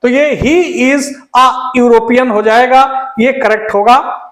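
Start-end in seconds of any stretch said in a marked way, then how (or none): 0.4-2.3 slow corrected sentence; very slow teacher reading; slow dictation. Shown as a demonstration. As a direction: lay it out word by word. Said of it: "ए लिखेंगे".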